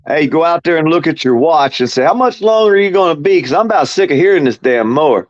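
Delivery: fed up, snap at the neighbor; grumpy neighbor. Acted.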